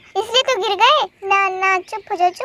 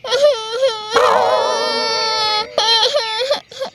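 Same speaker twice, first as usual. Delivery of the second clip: put-on long drawn-out kid's wail; child wailing; 2 semitones higher than usual. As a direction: keep sobbing like a hurt child. Child keeps crying.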